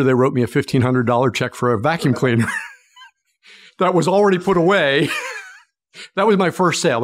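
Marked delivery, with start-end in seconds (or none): 3.8-7.0 laughing. Real, not acted. Laughing at his own sale story.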